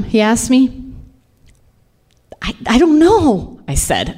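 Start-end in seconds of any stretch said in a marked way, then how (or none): 2.4-3.6 high, faltering frightened answer; terrified. Retold or imitated. Wife answering her husband's question about Jem, terrified. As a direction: stammer it out high, afraid to answer. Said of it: "I was terrified".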